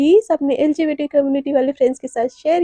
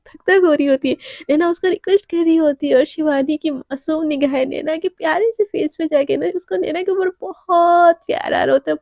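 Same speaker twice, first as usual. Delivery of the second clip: usual speaking voice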